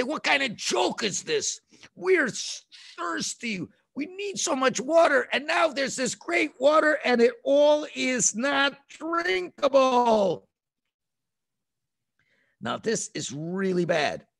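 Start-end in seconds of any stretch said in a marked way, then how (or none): 0.0-10.5 high whiny complaint; complaining people. Retold or imitated. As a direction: sound parched and fed up. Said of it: "They say".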